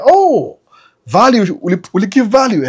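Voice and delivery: a high, sing-song mock teller greeting; cheery bank clerk. Bank clerk chirping to a depositor, high and sing-song. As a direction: play the bank clerk all sweet and high.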